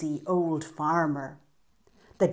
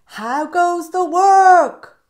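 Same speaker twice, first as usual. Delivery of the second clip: high, loud, slow bossy call; lazy farmer calling out to the duck, high and loud; 11 semitones higher, 14 dB louder, slower than usual. Acted. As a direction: call down lazily from bed. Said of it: "called the farmer".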